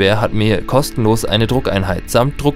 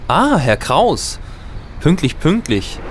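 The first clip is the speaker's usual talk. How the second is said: ironic greeting; 7 semitones higher, slower than usual. Acted. Ironische Begrüßung des Technikers.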